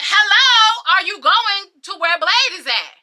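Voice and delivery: loud, high, pointed repeat of a question; insistent calling out. Retold or imitated. Repeats question to people ignoring her.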